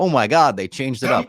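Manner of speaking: mock delight. Acting out a fan's delighted reaction.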